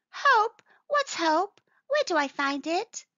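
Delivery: high-pitched, puzzled little creature; questioning little mole. Acted. Little mole asks mamma about hope, high and puzzled.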